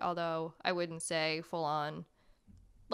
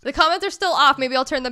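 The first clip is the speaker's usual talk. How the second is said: excited; 5 semitones higher, 12 dB louder than usual. Real, not acted. Laughing exclamation.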